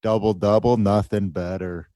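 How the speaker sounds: mock-Canadian accent, savoring each word; fake Canadian accent. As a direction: relish it in a hammed-up Canadian accent. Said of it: "not even a Canadian accent".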